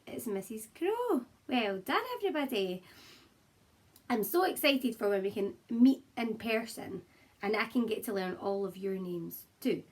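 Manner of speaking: ordinary delivery, nothing marked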